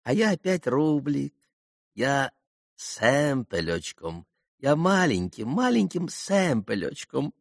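high, happy little mutter; blissful mumbling. A narrator's reading. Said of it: "блаженно бормотал Максимов". (блаженное бормотание)

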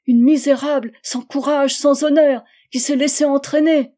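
plus haute et bien plus forte, montant; s'accabler soi-même avec rage; tirade amère. Acted. (Charlotte rejette le raisonnement de Georges, furieuse et honteuse)

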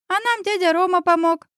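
put-on high-pitched little boy; small boy blurting it out; little son. A narrator's reading. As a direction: give away the news in a child's voice. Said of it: "рассекретился сын".

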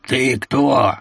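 lightly voiced smoker's rasp; a hint of a creaky, smoke-worn voice; grating voice. A narrator's reading. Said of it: "раздался прокуренный скрипучий голос".

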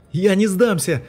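high, loud defiant scream; defiant yell. A narrator's reading. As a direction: scream refusal. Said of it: "заорал вновь тот же голос".

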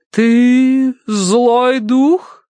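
high, very slow challenge; medium questioning spirit. A narrator's reading. Madame asks if it is evil, slow intoning. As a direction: draw each word out eerily.

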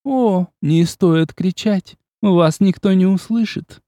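high, slow, silky woman's voice; pleasant female voice. A narrator's reading. The unseen countess mocking Agnes, lightly voiced. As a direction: tease them sweetly. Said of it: "Приятный женский голос".

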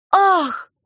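short, high-pitched gasp of shock; startled cry. A narrator's reading. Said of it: "вскрикнула Валечка". (испуганный вскрик)

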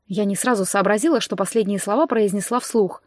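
ordinary delivery, nothing marked

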